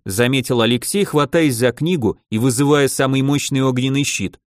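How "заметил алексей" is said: plain reading voice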